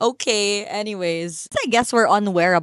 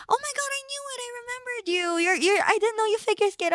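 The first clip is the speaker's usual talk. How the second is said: much higher, softer, delighted owner voice; excited shop owner; 14 semitones higher, 5 dB quieter, faster than usual. Retold or imitated. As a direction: play the gushing owner, pitch up and hushed. Owner voiced recognising her.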